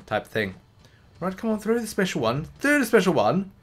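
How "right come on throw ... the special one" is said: coaxing the game. Real, not acted.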